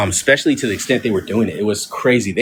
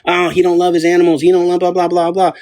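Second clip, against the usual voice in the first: mocking commenters; 5 semitones higher than usual. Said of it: "Everybody that's new here for the very first time".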